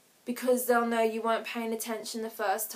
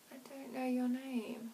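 softer, slow, hushed confession; sheepish aside; 11 dB quieter than usual. Pretend. Caught not knowing an acquaintance's name, sheepish aside. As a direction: let it slip under your breath. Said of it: "you'll be like".

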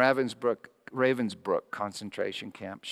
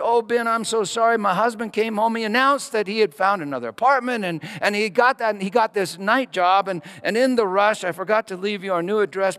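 apologetic woman; 10 semitones higher, 9 dB louder than usual. Retold or imitated.

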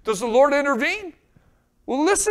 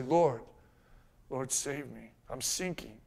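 quiet plea; 9 semitones lower, 9 dB quieter, slower than usual. Acted. Quiet prayer cry, voiced low.

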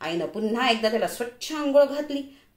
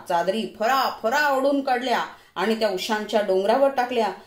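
plain reading voice

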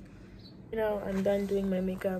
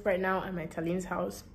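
usual speaking voice

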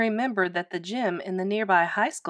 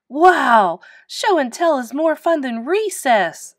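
put-on high, loud delighted kids; gleeful exclamation, big and bouncy; excited yell; 6 semitones higher, 8 dB louder, slower than usual. A narrator's reading. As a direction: yell it like thrilled classmates. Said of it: "they yelled".

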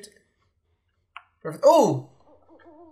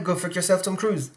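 usual speaking voice

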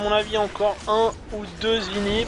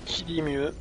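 soft, low repeat of the call; hushed echo; 3 semitones lower, 8 dB quieter than usual. Pretend. Caster echoing his own question to himself, hushed. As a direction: drop it right down, as if asking oneself.

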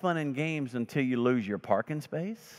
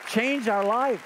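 preaching peak; 7 semitones higher, 4 dB louder than usual. Real, not acted. Preaching climax on grace.

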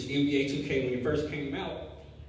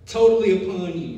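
louder, higher call to the church; rising preaching; 6 semitones higher, 3 dB louder than usual. Acted. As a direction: stir the congregation up. Preaching rises again.

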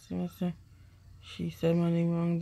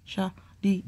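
usual speaking voice

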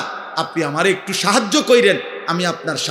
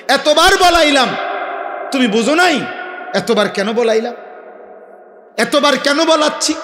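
pressing reproach; 6 semitones higher than usual. Acted. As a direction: hammer the question at the crowd. Preacher pressing the audience. (উঁচু গলায় চাপ দেওয়া প্রশ্ন)

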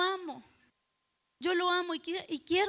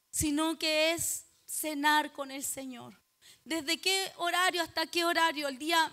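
normal talking voice

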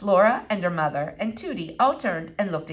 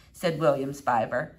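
usual speaking voice

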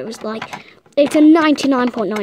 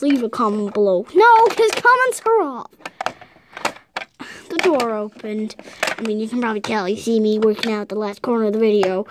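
ordinary talk

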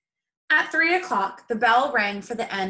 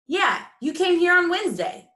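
put-on higher, louder eager boy; 10 semitones higher, 6 dB louder than usual. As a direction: chime in eagerly. Boy eagerly explains the name.

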